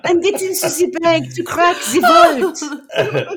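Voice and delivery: hammed-up laughing cat burglar bragging; mock heist boast. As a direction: brag about your vital heist job, giggling. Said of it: "mock bank-robbery role lines".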